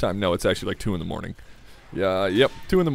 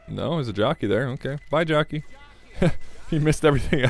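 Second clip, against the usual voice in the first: usual speaking voice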